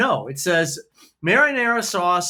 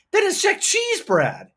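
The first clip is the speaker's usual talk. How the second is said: exasperated squeak; 12 semitones higher than usual. Real, not acted. Ranting about the pizza label.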